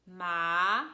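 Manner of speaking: "ma" drawn out, sliding upward like a question; questioning upward glide on "ma"; second tone. Shown as a demonstration. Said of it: "the tone goes down and up".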